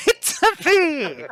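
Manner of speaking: put-on slow, laughing, high and loud Italian accent; a laughing Mario-style Italian line; mock Italian accent. Player mocks the shopkeeper in a slow, laughing Italian accent. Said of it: "it's me, uh, Lucamo".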